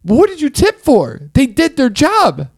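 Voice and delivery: high, slow, exasperated questioning; indignant. Retold or imitated. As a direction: talk down to her, baffled. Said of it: "I'm like".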